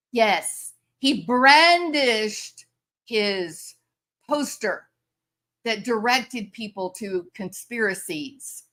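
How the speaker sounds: ordinary delivery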